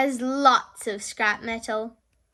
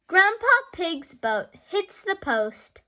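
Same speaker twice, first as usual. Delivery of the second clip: ordinary delivery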